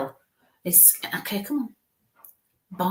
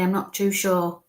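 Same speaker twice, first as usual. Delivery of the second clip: normal talking voice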